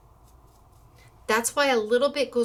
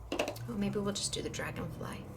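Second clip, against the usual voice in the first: whispering; 9 dB quieter than usual. Real, not acted. Musing to herself.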